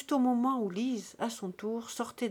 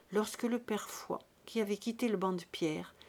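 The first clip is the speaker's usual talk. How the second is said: usual speaking voice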